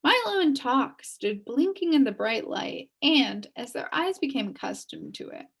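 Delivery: ordinary delivery, nothing marked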